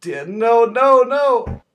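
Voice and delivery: high panicked yelping, near screaming; trembling whiny yelps. Real, not acted. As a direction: yelp it out in real panic. Panics as orcs attack him.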